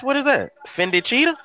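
high disbelieving question; incredulous. Real, not acted. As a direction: pitch way up in disbelief. Mocking outfit, repeated by friend.

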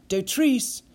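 sharp high startled question; blurted surprise. Acted. Nissen startled by the name, blurting it out high. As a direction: jolt out the name in shock. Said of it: "Nissen blurted out".

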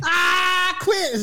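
high-pitched mock agonized cry; hammed-up, high submission wail; Finn giving up. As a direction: imitate a wrestler yelling in pain. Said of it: "Finn will probably be like".

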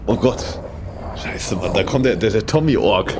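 ordinary talk, nothing marked

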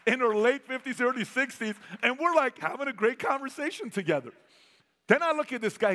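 ordinary talk

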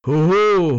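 ordinary delivery

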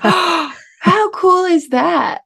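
high, drawn-out amazement; retold amazed delight. Retells own delighted reaction.

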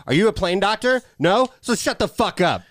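mock-condescending put-down; bitchy retort. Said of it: "she's being a bitch".

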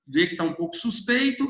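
usual speaking voice

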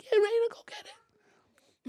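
very high mock yell; imitated mother's scream. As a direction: imitate a screaming mom cheering from afar. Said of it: "You hear her screaming in the background".

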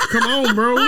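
laughing. Real, not acted. Laughing invitation to caller.

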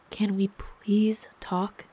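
slow, drawn-out gentle plea; weary, hesitant appeal; pleading. A narrator's reading. Wife pleading to talk after quarrel.

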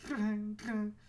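imitated rotary phone ring. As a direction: ring like an old telephone. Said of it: "It doesn't sound like a rotary phone".

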